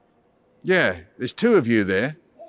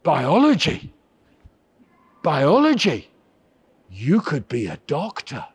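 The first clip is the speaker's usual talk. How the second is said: dad. Retold or imitated. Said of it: "And my dad went".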